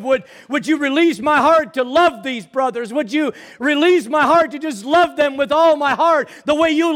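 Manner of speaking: loud, high, impassioned sermon delivery; full-force preaching. Acted. Preacher crying out to his congregation, full force. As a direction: lift the congregation, preaching hard.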